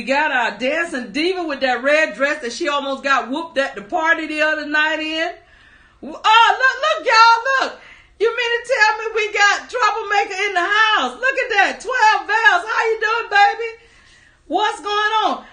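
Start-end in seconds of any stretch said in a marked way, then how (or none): 6.0-15.4 high, loud, delighted welcome; laughing, high-pitched surprise at an arrival; excited greeting. Real, not acted. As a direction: pitch it up and call out to a friend. Greets viewer who just arrived.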